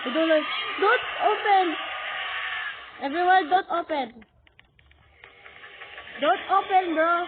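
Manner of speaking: loud, urgent warning; panicked pleading. Pretend. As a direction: shout it fast, begging them to stop. Begs others not to open door.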